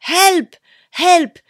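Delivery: high, loud cries for help; panicked high shouts of help; shouting for help. Retold or imitated. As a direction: call out for help in alarm like a lost tourist.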